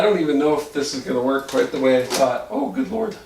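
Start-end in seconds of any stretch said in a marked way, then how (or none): 2.5-3.2 high, strained from the cold; strained high gasp. Real, not acted. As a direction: squeak it out in cold shock. Ice against skin.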